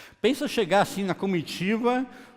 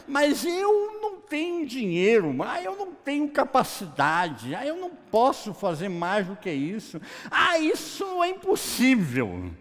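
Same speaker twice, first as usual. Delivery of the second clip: protesting self-doubt; 8 semitones higher than usual. Acted. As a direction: plead that it can't be done, pitched high. Voicing a doubter's excuses. (protesto de quem duvida, agudo e arrastado)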